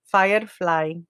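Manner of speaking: put-on firefly said slowly in a Siri-style New York English accent. Imitating Siri's New York accented firefly. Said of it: "así mismo con ese acento".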